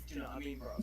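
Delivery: hint of a London bloke; mock South London accent. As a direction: mumble it like a local lad. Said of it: "everybody and their dog talks like this".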